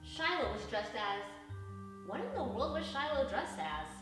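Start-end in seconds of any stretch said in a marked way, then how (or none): none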